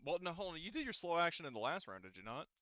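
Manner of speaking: ordinary delivery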